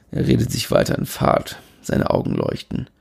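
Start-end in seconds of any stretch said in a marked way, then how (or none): none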